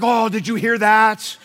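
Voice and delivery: slow, loud, starry-eyed gasp; awed churchgoer. Retold or imitated. Congregation member, swooning over a pastor's accent. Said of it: "And everybody's like".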